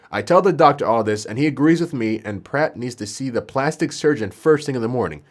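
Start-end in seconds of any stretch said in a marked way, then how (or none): none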